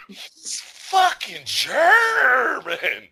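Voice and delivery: high, very drawn-out yell on GERMAN; climactic comic shout. Real, not acted. Host lands his verdict to laughing co-host, climactic shout. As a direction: belt it out slowly as the punchline.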